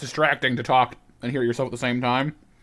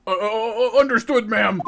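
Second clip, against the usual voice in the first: higher, nervous stammered reply; nervous guard; 7 semitones higher, 3 dB louder, slower than usual. Acted. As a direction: answer the boss meekly. Voicing guard answering boss.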